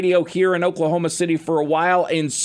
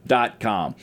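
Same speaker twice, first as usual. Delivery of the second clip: usual speaking voice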